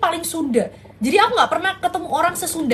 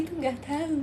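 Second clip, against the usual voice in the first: normal talking voice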